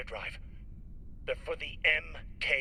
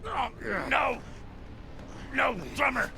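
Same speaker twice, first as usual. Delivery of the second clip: rising, desperate screams for mercy; panicked screaming; 11 semitones higher, 3 dB louder than usual. Acted. Cox begging Drummer as he is dragged to the airlock, panicked screaming. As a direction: scream louder and higher with each plea.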